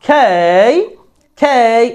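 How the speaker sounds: "খেই" stretched with a puffed K; drawn-out letter K. Shown as a demonstration. Teacher showing alphabet letters, long aspirated খেই. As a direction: hold the glide in "খেই". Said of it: "একটু টান".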